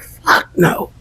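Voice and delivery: breathless whisper under the breath; whispered fuck no. Retold or imitated. Pretending to sleep, scared of the witch, whispering. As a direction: mouth it in a frightened whisper. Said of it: "I'm like".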